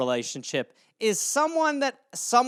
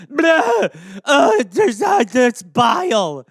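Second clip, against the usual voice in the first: loud retch then slow gagging words; fake retch; 5 semitones higher, 9 dB louder, much slower than usual. Acting sick after tasting the product, loud slow gagging. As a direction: heave and choke on it. Vomiting imitation.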